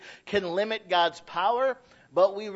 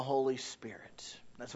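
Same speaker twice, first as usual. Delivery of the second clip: unvoiced hushed whisper; 5 semitones lower, 10 dB quieter than usual. Real, not acted. Preacher whispers a secret to his church. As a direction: lean in and whisper it.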